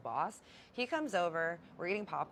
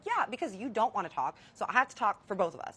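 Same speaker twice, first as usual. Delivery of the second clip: louder, exasperated comeback; heated retort in a marital fight, louder; retold argument; 4 semitones higher, 7 dB louder than usual. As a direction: snap back at him, fed up. Arguing with husband over dinner.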